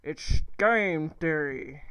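loud, drawn-out show catchphrase; Game Theory catchphrase. Retold or imitated. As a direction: belt out the catchphrase, stretching each word slowly. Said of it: "You know that game theory?".

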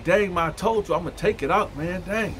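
retold annoyed comeback to mom; exasperated son. Son snaps back at mother.

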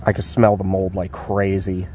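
usual speaking voice

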